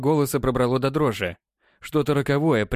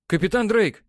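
shouting; 9 semitones higher than usual. A narrator's reading. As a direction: shout the name to be heard. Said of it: "вновь прокричал граф".

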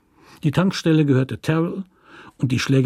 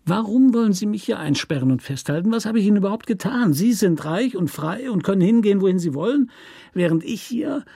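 higher, whining plea; nasal whining complaint, higher pitched; half-whining nasal voice; 7 semitones higher than usual. Acted. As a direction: whine it out, pleading fast. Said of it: "mit seiner halb winselnden Näselstimme".

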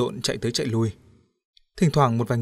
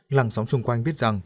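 ordinary delivery

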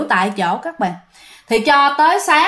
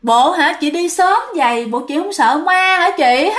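ghost calling; 5 semitones higher than usual. Acted. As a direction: tease the passing women like a lurking ghost. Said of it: "nghe tiếng kêu".

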